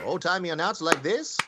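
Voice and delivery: lightly voiced old-time radio announcer; a hint of a vintage announcer; old-timey announcer. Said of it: "I bet you do a good old-timey announcer guy".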